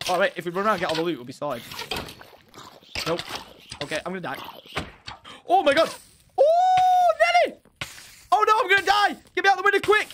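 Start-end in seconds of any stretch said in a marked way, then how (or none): 5.5-10.1 high, loud panicked yelling; panicked screaming. Real, not acted. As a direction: scream in panic for your life.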